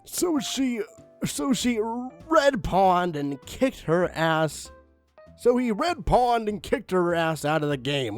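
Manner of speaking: ordinary talk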